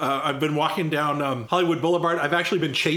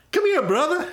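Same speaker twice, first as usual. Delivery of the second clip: high, loud flamboyant [name] voice; [name] impression; 14 semitones higher, 4 dB louder than usual. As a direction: call out high and showy like a rock star.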